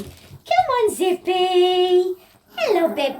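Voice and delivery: high sing-song call; pet voice. Real, not acted. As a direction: sing the pet's name. Calling Zippy over.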